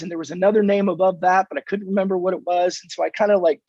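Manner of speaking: ordinary delivery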